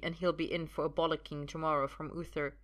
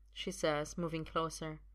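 ordinary delivery

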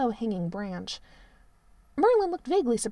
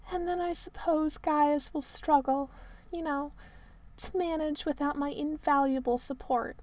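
hammed-up tragic Merlin; 6 semitones higher than usual. A narrator's reading. Merlin moans to the prince about his cold room.

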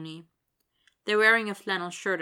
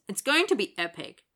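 excited, louder hoot to friends; 3 semitones higher, 5 dB louder than usual. A narrator's reading. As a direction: keep cheering as you say it. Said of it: "the smaller man hoots".

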